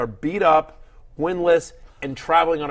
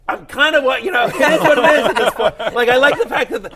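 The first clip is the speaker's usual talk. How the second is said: high, loud, laughing through the words; laughing, raised-voice shrug; amused self-defense; 9 semitones higher, 8 dB louder than usual. Real, not acted. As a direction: protest loudly through real laughter. Defends himself amid laughter over complaints.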